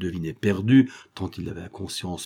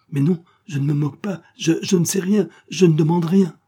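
higher, louder, stumbling denial; flustered protest; 7 semitones higher, 5 dB louder than usual. A narrator's reading. Von Kopfen defending himself to the policeman, flustered. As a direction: protest helplessly under pressure. Cornered victim protests under questioning.

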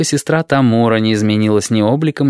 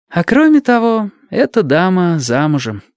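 a little tired; 9 semitones higher than usual. Acted. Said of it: "немного устало добавила".

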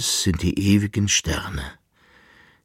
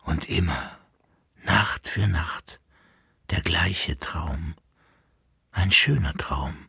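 dreamy quiet; 3 semitones lower, 6 dB quieter than usual. Acted. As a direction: muse half-awake. Esther leise nach dem Erwachen. (verträumt leise)

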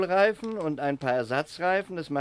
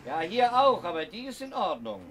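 calling back; 9 semitones higher than usual. Acted. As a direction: answer from far off. Calling back across field.

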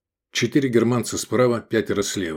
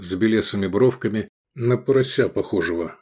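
usual speaking voice

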